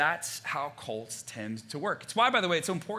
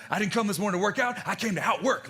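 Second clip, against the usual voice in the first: hyped fitness coach; 6 semitones higher, 4 dB louder than usual. Retold or imitated. Quoting hyped Peloton coach.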